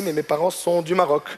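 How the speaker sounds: ordinary talk, nothing marked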